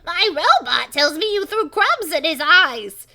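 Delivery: high, very loud, slow professor voice; throat-punishing cartoon shout, drawn out; harsh character voice. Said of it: "such hell in my voice".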